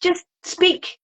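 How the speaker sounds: high, exasperated plea; exasperated complaint. Retold or imitated. Complaining to the audiobook narrator.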